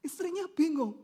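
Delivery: ordinary delivery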